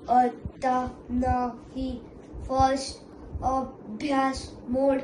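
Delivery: slow, level robot refusal; a machine switching to study mode; strict robot. Acted. Robo replies in robot voice.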